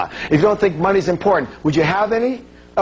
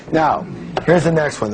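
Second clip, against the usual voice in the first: normal talking voice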